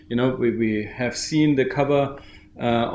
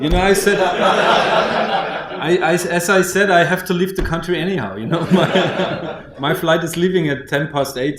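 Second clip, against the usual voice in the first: talking through laughter, higher and louder; laughing; 4 semitones higher, 4 dB louder than usual. Real, not acted. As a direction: chuckle through the words. Jokes about leaving the country.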